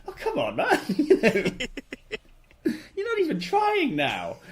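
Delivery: high, laughing disbelief; laughing exasperation. Real, not acted. Fan laughing at an actor's lazy accent, high laughing exasperation. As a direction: laugh it out, mock-pleading with the actor.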